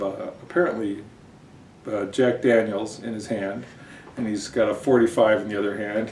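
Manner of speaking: normal talking voice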